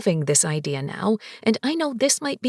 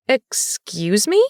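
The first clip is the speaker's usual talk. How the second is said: indignant; 4 dB louder, much slower than usual. A narrator's reading. Shocked by insult.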